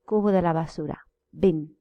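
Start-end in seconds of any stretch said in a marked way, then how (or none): 1.4-1.7 Bin with a short ɪ. Shown as a demonstration. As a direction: clip the i in Bin. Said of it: "Let's see the difference".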